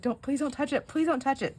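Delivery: high-pitched anxious begging; mock urgent plea; pleading child, imitated. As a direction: beg like a worried little boy. Son begging her not to touch.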